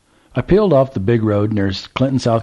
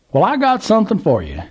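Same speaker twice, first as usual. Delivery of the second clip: put-on old Southern gentleman; 12 semitones higher, 3 dB louder than usual. A narrator's reading. Old man calls to trucker, high Southern voice. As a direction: play the curious old man at the gas pump.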